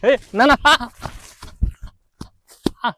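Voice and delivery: high panicked yelp; panicked cry. Real, not acted. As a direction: yelp in sudden fright. Chased, panics.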